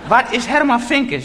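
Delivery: high excited whispering women; gossiping women. Retold or imitated. Women in the train spotting the comedian, high and eager.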